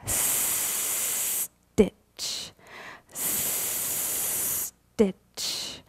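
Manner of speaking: breathy whispered stitch; hushed rhythmic whisper. Acted. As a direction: barely voice each stitch.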